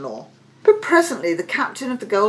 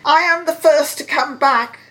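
higher, louder, eager announcement; triumphant claim; 10 semitones higher, 7 dB louder than usual. A narrator's reading. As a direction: announce it proudly to the king. Suitor boasts of returning first.

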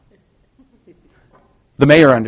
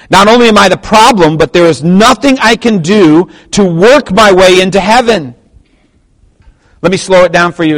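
louder, higher pulpit delivery; forceful preaching; 7 semitones higher, 9 dB louder than usual. Acted. Preacher reads the confession to the church, forceful preaching. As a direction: read and expound it with force.

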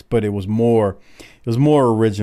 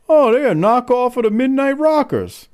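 put-on very high-pitched kid voice; imitating young kids; 4 dB louder than usual. Young kids at a wrestling match commenting, mocking high kid voice. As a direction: squeak it out like a know-it-all kid. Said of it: "a couple of the young kids go".

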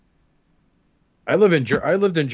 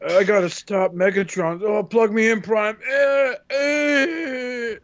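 hammed-up toy voice; 6 semitones higher, slower than usual. Toy weaponizer voiced, moaning.

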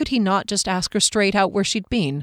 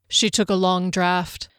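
ordinary delivery, nothing marked

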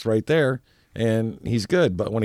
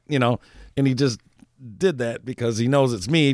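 ordinary talk, nothing marked

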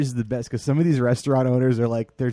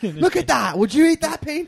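high, loud screaming chef; Gordon Ramsay impression; 14 semitones higher, 7 dB louder than usual. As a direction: bellow at the cook. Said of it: "Gordon Ramsay be like".

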